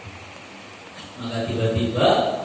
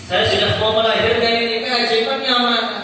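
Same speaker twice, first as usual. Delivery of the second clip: impatient Aminah; 5 semitones higher, 4 dB louder than usual. Acted. Aminah asks where the miracle is.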